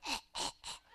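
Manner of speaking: imitated choking, high airless gasps. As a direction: gasp like someone being choked out. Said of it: "choke a little boy out and be like".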